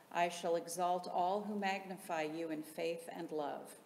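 ordinary talk

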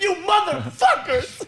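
high, slow mock yell; laughing mock curse; mock outburst. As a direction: rage at them in jest. Imitating Jimmy's outburst.

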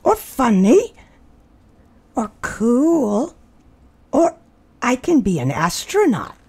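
put-on high, eager young mole rat; dreamy kid listing what he can be; Wilbur's voice. A narrator's reading. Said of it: "Wilbur character voice".

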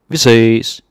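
Vi ses called loudly across the room. Shown as a demonstration. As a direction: shout Vi ses as a friendly farewell. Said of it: "cheerful call-out".

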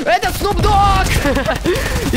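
high, slow amazed exclaim; surprised exclaim. Real, not acted. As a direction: gasp it out in disbelief. Sees boss, exclaims.

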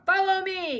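high, loud command; ordering. A narrator's reading. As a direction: lead the march. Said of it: "he ordered".

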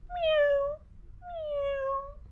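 high, soft mewing cat; high gentle meow. Acted. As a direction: mew it up high like a kitten. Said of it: "said Cat".

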